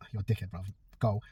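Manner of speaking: low, very soft brush-off; offhand dismissal. Acted. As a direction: shrug off the insult under your breath. Imagines someone brushing off an insult.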